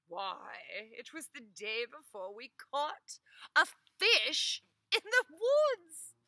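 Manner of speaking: high, loud, slow put-on voice; wife eagerly recounting the strange day. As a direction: tell it earnestly.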